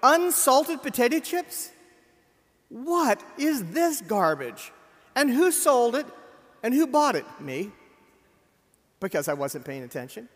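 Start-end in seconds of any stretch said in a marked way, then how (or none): 0.0-7.2 loud, slow, disgusted outburst at the bag of chips; shouting at unsalted chips in the dark kitchen, appalled; outraged rant. Retold or imitated. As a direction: rant it like the chips are a personal insult. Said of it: "and you go".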